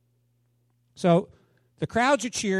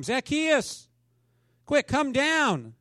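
higher, slow calling out to the man in the tree; 8 semitones higher, much slower than usual. Acted. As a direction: call up to Zacchaeus in the tree. Jesus calls up to tree.

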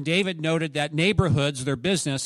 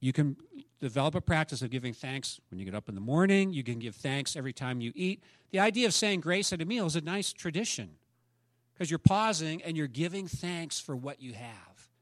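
normal talking voice

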